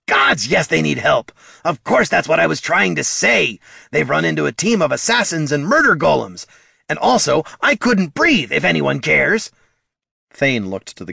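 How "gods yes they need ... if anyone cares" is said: high, loud squawk of outrage; indignant kobold. Acted. Kobold, free of the cigarette, ranting at the troll. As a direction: sputter it out, offended no one noticed.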